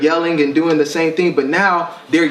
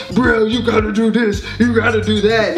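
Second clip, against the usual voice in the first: higher, lightly mimicking a little boy bossing his sister; imitating son yelling; 7 semitones higher than usual. Dad imitates son yelling at his sister, lightly mimicked boy voice. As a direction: play the son giving orders, a bit higher. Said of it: "he was yelling at Brielle".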